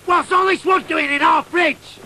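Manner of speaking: high loud repeated demand; mum shouting. Retold or imitated. Mum asking again about the wood, shouting. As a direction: lose patience and yell.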